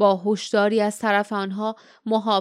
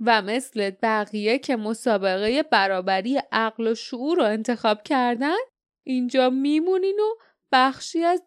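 gloating guardian; 2 semitones higher than usual. Acted. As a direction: relish the threat, drawing out the final doom.

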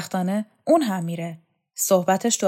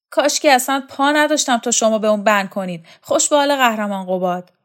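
fast, louder whining complaint; a put-upon man grumbling quickly; a touch of self-pity, rushed; whiny complaint; 4 semitones higher, 7 dB louder, faster than usual. Acted. Ghadir complains while being scrubbed.

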